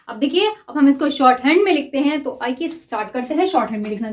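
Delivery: ordinary talk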